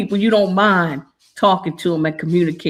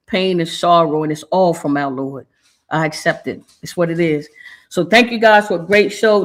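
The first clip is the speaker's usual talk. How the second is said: ordinary talk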